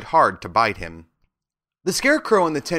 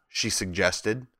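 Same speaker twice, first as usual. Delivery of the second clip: plain reading voice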